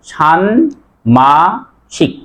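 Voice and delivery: ষান্মাসিক very slowly as shan, ma, shik; syllable by syllable. Shown as a demonstration. Teacher counts syllables for students, very slow, broken-up ষান্মাসিক. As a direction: stretch ষান্মাসিক into three separate syllables. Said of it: "অক্ষর ছিল কয়টা?".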